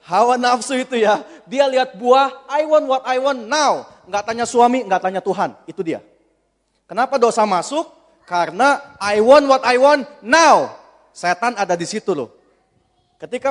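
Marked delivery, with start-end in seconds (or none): 2.5-3.8 higher, slow, insistent cry; greedy demand. Acted. Acting out Eve's greedy demand. 9.0-10.7 loud, slow, hammered-out words; insistent mock shout; selfish demand. Acted selfish demand, repeated.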